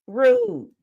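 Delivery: the repeat goes up into a comic falsetto; mock shocked rude. Real, not acted. Host repeats her verdict in a high, squeaky mock voice.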